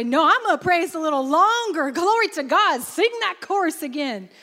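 decide out loud to keep praising; pressing into praise. Acted. Preacher voicing a worshipper choosing to praise longer, excited. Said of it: "stop and say".

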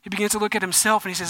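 loud, driving sermon delivery; preacher pushing the story to the congregation; preaching at full force. Acted. Pastor retelling Peter sinking, preaching full force.

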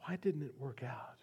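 whispered, drained after the outburst; broken whisper. Retold or imitated. Grieving Mary, to herself, defeated whisper. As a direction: let the grief collapse into a whisper. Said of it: "Think what must have been running through her mind".

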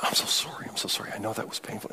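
soft whispered sorry; whispered apology. Retold or imitated. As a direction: hush it like an apologizing cashier. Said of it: "at the register said".

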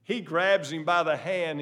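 normal talking voice

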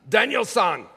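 Mister Miyagi. Acted. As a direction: do a stern mentor in a put-on Japanese accent. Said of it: "in my best Japanese accent".

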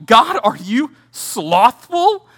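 slow, stretched mock question; drawn-out accusation. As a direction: drag the question out slowly, incredulous.

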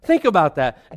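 normal talking voice

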